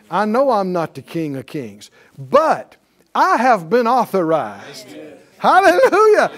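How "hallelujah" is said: real high, loud praise shout; exultant shout. Real, not acted. Preacher rejoicing at being authorized, exultant shout. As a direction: burst out in praise, far above talking pitch.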